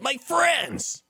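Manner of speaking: anime hero voice. Shown as a demonstration. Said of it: "only voice I could do".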